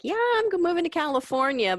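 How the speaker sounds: high, loud, gushing announcement; mock excited announcement. Host imitating a grad telling friends she's moving, high and loud. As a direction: squeal out the big news.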